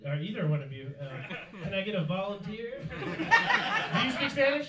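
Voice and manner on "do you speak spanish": slow, high, drawn-out question; hammed-up question. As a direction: stretch it out high for laughs. Asks audience member, drawn out.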